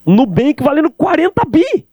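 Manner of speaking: high, slow, astonished; a mock-astonished high cry; disbelief. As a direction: gasp out the figure in disbelief. Astonished imagined banker.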